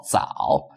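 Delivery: 早 said low in a dipping third tone; 早 as a careful tone-three demonstration. Shown as a demonstration. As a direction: let 早 sink low like a Mandarin tone three. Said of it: "third tone".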